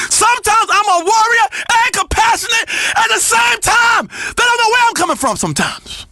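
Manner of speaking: high, shouted proclamation; fervent warrior boast. Acted. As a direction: work yourself up into a preacher-like cry.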